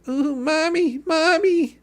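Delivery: mock a crybaby wailing for mommy; high mocking crybaby whimper; whiny baby voice. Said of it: "Sitting there going".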